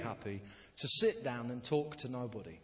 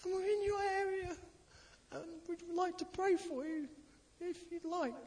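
much higher, overly sweet and polite church greeter; high, sugary "we're from the local church" act; mock sweet doorstep voice. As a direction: play it as a saccharine doorstep greeting. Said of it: "and we say".

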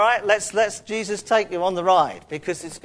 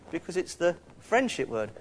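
ordinary delivery

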